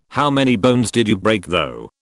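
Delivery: ordinary talk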